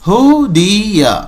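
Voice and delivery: slow ہو دیا with a light h. Shown as a demonstration. Teacher models the light ha slowly. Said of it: "ہلکا کہیں گے اسے".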